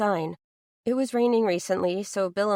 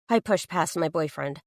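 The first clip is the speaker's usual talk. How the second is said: plain reading voice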